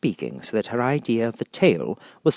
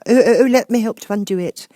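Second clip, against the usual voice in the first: high, loud, flustered offer; anxious eager Alice; 12 dB louder than usual. Acted. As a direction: rush to help anxiously. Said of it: "looking anxiously about her".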